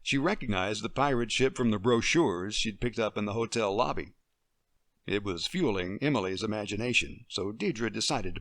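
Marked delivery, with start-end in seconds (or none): none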